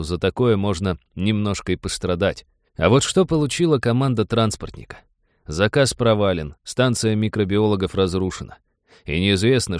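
usual speaking voice

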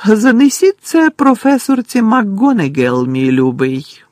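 high, sweet, falsely tender command; sugary Umbridge order. A narrator's reading. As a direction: hand over the note with sickly sweetness. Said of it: "звеліла професорка Амбридж".

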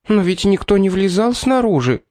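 high-pitched squeal of a panicking cook; squealed, alarmed question; shrill, near panic. A narrator's reading. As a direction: ask shrilly, on the edge of panic. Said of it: "визгливо спросила кухарка, уже почти паникующая".

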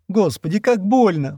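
high, sobbing cry of pain; high whimpering wail of pain. A narrator's reading. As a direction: wail through the hurt. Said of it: "всхлипнув".

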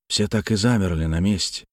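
usual speaking voice

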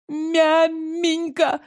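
high, slow, trembling whine; тянуть жалобно, высоко, дрожа; trembling whisper. Acted. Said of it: "прошептала толстуха дрожащим голосом". (дрожащий писклявый шёпот)

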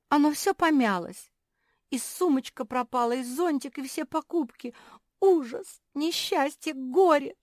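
very high, loud wailing; whimpering lament. A narrator's reading. Naretta bewailing her ruined dress and lost shopping, whimpering. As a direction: pile up the woes, hammed-up despair. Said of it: "захныкала Наретта".

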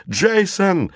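high, frightened cry of a name; terrified scream. A narrator's reading. Girl sees shadow, screams to boy in terror. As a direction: scream out to your friend in panic. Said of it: "закричала Анита не своим голосом".

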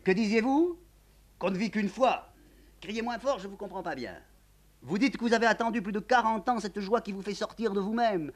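a hint of a wry old count speaking through a door; lightly voiced old count, teasing through a door; character voice.

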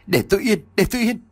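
high-pitched, mildly shouted plea; pained yelling. A narrator's reading. Long yells at the voice in his ear, mild pained shout. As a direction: cry out high to drive the voice away. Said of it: "Long hét lên vì đau".

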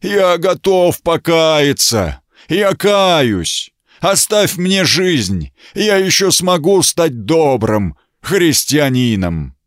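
high, slow roaring, rasping plea; roaring beast voice. Acted. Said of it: "В голосе слышались рёв, клёкот, хрипы".

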